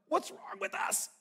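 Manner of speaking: hushed exasperation; exasperated whisper. Acted. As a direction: throw it away in disbelief. Exasperated aside.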